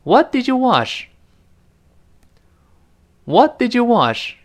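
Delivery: What did you wash, What high and stressed; 慢慢问 What did you wash，What 读高; slow model question. Shown as a demonstration. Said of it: "我们跟老师再读一遍". (跟老师读 What did you wash)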